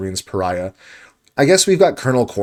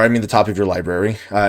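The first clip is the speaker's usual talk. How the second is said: usual speaking voice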